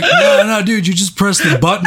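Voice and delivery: laughing through the words. Retold or imitated. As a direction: crack up while you say it. Ian laughing while correcting guy.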